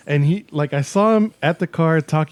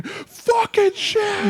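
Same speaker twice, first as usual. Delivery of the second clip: long, high-pitched screamed swearing; mock scream; much slower than usual. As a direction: stretch the curse into a high shriek. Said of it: "Then he just starts screaming".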